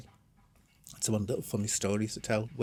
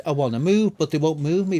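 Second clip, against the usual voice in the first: retold confrontation; 5 semitones higher, 8 dB louder than usual. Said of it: "told them all straight to the face".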